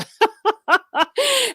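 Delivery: a burst of loud, high laughter. Real, not acted. Laughing loudly about coming from Missouri. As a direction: laugh out loud at her own roots.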